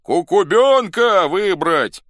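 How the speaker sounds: high, very loud, drawn-out cry; crowd shout. A narrator's reading. One faction puts forward its candidate, shouting. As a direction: yell the candidate's name slowly. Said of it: "кричала часть".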